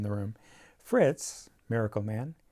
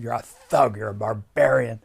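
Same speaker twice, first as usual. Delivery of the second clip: louder, half-acted tirade from a furious director; mock the director tearing into him; retold angry outburst; 7 dB louder than usual. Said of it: "he went ballistic".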